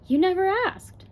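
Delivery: lightly acted, high-pitched retort; playful retort. A narrator's reading. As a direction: answer back with a high lilt. Grandmother's teasing retort in story.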